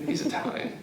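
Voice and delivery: fading repeat. Retold or imitated.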